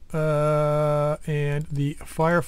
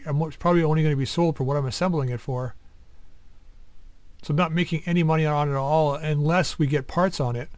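ordinary delivery

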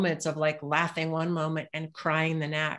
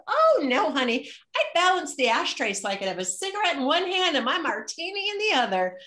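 much higher, laughing sassy mom; mother's voice; 11 semitones higher than usual. Retold or imitated. Mother joking to her daughter about ashtrays, much higher sassy. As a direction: tease her with the punchline.

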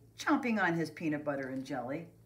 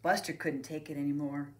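ordinary delivery

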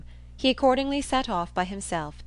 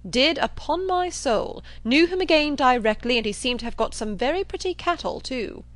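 raised, emphatic assertion; blustering John Thorpe; 5 semitones higher, 5 dB louder than usual. Acted. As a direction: insist with brash certainty. Thorpe swears to it.

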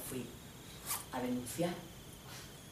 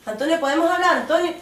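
llamada insistente y aguda de la novia; llama a Antonio con tono de reproche; novia que reclama; 10 semitones higher, 5 dB louder than usual. Acted. (novia llamando con insistencia)

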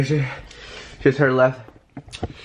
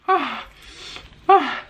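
pained gasps; 13 semitones higher than usual. Real, not acted. Eating very spicy wings.

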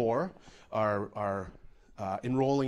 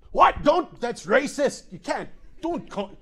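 high, loud mock outrage; hammed-up offended protest; 7 semitones higher, 4 dB louder than usual.

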